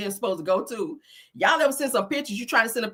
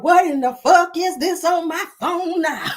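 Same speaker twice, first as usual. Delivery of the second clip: higher, louder, slow outraged attorney; imitating city attorney; 7 semitones higher, 5 dB louder, slower than usual. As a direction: drag out the disbelief. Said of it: "City attorney's like".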